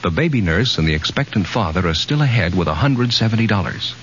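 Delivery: ordinary talk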